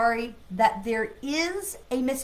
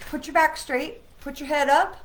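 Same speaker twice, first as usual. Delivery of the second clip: firm coaching; 6 semitones higher, 6 dB louder than usual. Shown as a demonstration. Coach corrects posture after bad example.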